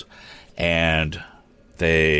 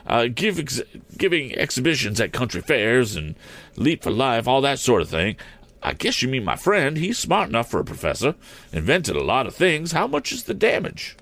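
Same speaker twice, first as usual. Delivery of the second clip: higher-pitched, lightly voiced showman; balloonist character; 6 semitones higher than usual. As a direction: explain yourself in a slightly put-on higher voice.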